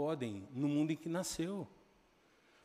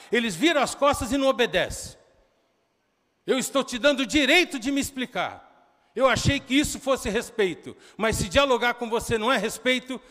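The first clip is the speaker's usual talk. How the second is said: acted heated shout; 7 semitones higher, 10 dB louder than usual. Son retold explaining, shouting.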